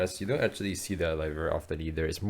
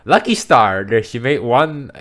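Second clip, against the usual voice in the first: excited; 5 semitones higher, 11 dB louder than usual. Real, not acted. Excited discovery, much louder.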